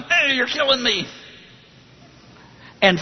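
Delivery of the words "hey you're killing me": high-pitched imitated cry of protest; mock yelling. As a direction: play the tormented learner yelling, without full volume. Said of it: "the learner starts yelling".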